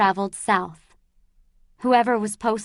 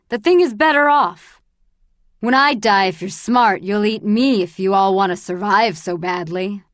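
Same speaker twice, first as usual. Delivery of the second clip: louder, harsh contemptuous rant; cruel rant; 6 dB louder than usual. Acted. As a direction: sneer cruelly at them all. Bald man mocks the grieving.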